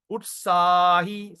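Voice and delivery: utsahi with the middle A held long; pulled vowel. Shown as a demonstration. Teacher gives an example of the dash, drawn-out vowel. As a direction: stretch out the SAA in utsahi, slowly. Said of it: "you pull the vowel".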